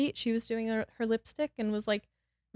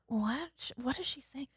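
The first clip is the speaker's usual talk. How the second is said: confused murmur; 3 semitones higher, 11 dB quieter than usual. Retold or imitated. Passengers confused by her cry.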